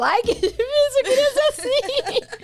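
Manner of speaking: high, laughing mock lament; a giggling, high-pitched self-pitying sigh; laughing whine. Mock whine quoting herself to husband.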